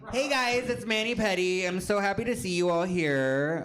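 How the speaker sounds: high, bright sassy drag queen greeting. Pretend. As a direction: camp it up like a drag queen on stage. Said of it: "Should I put my drag voice on?".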